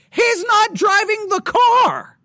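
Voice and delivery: loud exasperated outburst; outraged shout. Real, not acted. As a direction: shout it in disbelief at the injustice. Outraged at police asking for license.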